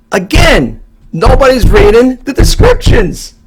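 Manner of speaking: high, loud, slow exasperated groan of complaint. Retold or imitated. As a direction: drag it out like you've had it with buyers. Exasperated seller retelling his reply.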